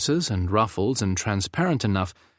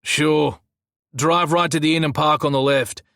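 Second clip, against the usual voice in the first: put-on pitched-up Scottish accent; Scottish man; 6 semitones higher, 4 dB louder than usual. A narrator's reading. Guard directs driver to parking, Scottish accent. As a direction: give directions in broad Scots. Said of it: "the Scottish man returned".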